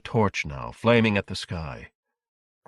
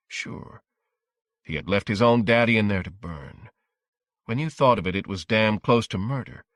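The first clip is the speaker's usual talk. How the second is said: usual speaking voice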